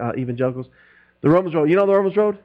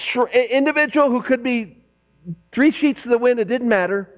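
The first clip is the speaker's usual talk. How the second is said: ordinary delivery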